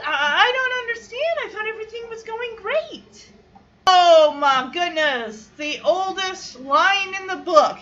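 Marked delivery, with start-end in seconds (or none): 0.0-3.3 high-pitched, mildly acted bewilderment; retold bewildered upset. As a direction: imitate her protesting in a much higher voice. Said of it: "confused and upset".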